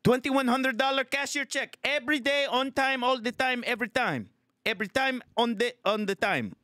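put-on high-pitched, indignant Filipino accent; livid Filipino tenant. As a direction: protest like a fed-up Filipino tenant. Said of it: "Filipino tenant that's fucking just livid".

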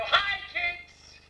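high cheery greeting; bright call-out. Shown as a demonstration. Host to the kids, a high cheery greeting. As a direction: call out to the crowd. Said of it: "I'm gonna go".

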